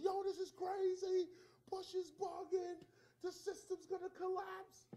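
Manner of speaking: high, loud, slow mock panic; mock alarmed ranting. Mocking the people who railed, with loud high panic. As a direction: ham up the nineties doom-sayers.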